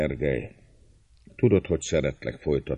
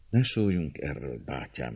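softly saddened, sinking away at the end; quiet, understated sadness; sad; 2 semitones lower, 5 dB quieter than usual. A narrator's reading. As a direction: say it with subdued sorrow, voice dropping. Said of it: "jegyzé meg szomorúan Gergely".

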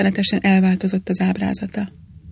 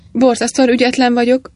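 ordinary delivery

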